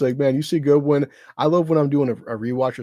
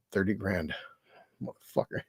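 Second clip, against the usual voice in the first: under-the-breath grumble, much softer; half-whispered scoff; muttered aside; 5 semitones lower, 11 dB quieter than usual. Retold or imitated. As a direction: mutter it to yourself in disbelief. Muttered retort to the pundit.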